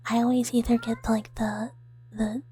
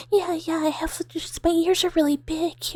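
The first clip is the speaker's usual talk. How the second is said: high, hurried-sounding excuse; mock reassurance; 6 semitones higher, 4 dB louder than usual. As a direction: reassure them in a raised little voice. Acting out her reassuring excuse.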